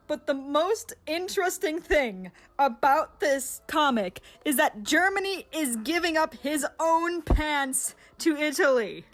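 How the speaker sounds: usual speaking voice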